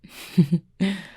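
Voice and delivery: short amused laugh; brief chuckle. Acted. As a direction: laugh it off lightly. Laughs at being called Fangs.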